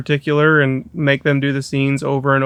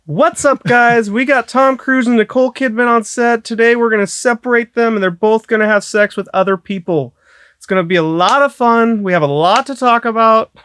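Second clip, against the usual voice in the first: hyped high YouTube intro voice; big excited vlogger hello; prank YouTuber voice; 8 semitones higher than usual. Retold or imitated. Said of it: "like, a prank YouTube channel".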